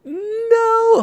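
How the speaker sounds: sudden high cry of disbelief; high startled yelp; shocked no. Acted. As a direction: jolt up in shock. Shocked reaction to reveal.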